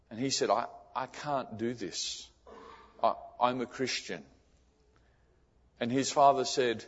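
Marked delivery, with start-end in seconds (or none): 0.5-4.2 quiet tearful refusal. Retold or imitated. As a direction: get the words out through the hurt. Son repeats refusal to father.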